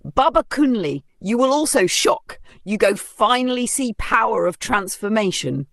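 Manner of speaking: high, giddy Pidgin-tinged brag; lifted, eager voice, bragging to the neighbours; excited boasting. Acted. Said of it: "She clapped her hands and spun around".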